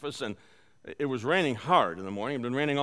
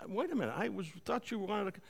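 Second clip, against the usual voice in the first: softer, higher, hesitant attempt to interrupt; gentle interjection; 5 semitones higher, 7 dB quieter than usual. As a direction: gently try to get a word in. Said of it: "I'm gently saying".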